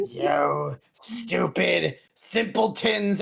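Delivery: slow sneering villain. Acted. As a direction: drawl it with contempt. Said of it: "behind you saying".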